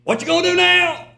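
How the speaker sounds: loud, high, gravelly old preacher imitation; Brother Miller. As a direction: belt it out in a gravelly mimicked voice. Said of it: "That's the way he talked".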